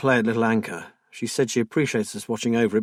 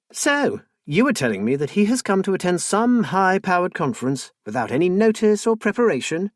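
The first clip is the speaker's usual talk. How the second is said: high, louder, sarcastic disbelief; incredulous; 7 semitones higher, 4 dB louder than usual. Acted. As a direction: pick his story apart, high and scornful. Wife disbelieving husband's story.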